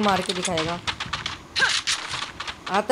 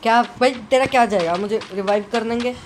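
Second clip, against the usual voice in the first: ordinary talk, nothing marked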